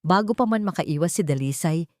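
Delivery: plain reading voice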